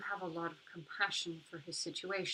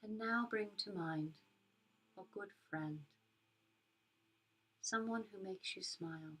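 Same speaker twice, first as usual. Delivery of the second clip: soft spa whisper; 4 dB quieter than usual. Acted. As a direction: guide them gently into calm. Leads a metta meditation.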